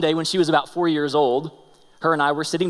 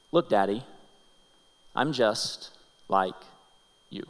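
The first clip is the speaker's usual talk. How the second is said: slow, soft and lower; slow hushed refrain, look Daddy; tender refrain; 6 semitones lower, 5 dB quieter than usual. Acted. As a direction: linger on each word like a gentle refrain. Daughter's message, slow refrain.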